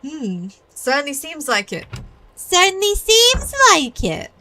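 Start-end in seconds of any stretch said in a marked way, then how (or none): 2.4-4.3 much higher and louder, hammed up; exaggerated repeat. Hammed repeat of same line.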